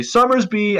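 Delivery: high sing-song call across the yard; calling the dog. Pretend. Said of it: "call for that dog and say".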